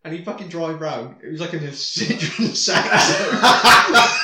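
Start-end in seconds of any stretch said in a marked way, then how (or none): none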